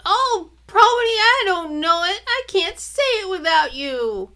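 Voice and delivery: put-on high, loud wailing little boy; little brother wailing he can't say his line, high and loud. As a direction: wail it in despair. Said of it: "And then he wailed".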